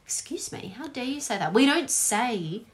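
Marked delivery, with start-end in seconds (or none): 0.0-1.5 a hushed, half-whispered "how dare you", slightly higher; mock offended. Mock Australian taking offence, hushed and scandalised. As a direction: feign shocked offence under the breath.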